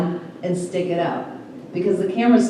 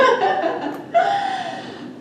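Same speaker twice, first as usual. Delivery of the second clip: laughter rising up high and falling away; 4 dB quieter than usual. Real, not acted. Laughing about the customer nitpicking one photo.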